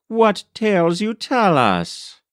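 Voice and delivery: high, scoffing jeer at the teller; mocking laugh. A narrator's reading. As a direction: scoff at his tall tales. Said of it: "laughed aloud at poor Iegu".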